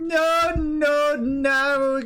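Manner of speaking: high, slow, wailing preacher; mock drunk vicar. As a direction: preach greed like a tipsy vicar.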